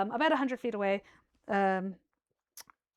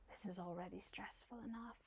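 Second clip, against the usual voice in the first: whisper; 4 semitones lower, 21 dB quieter than usual. Acted. GM voices weary captain Sapphire.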